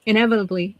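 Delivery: ordinary talk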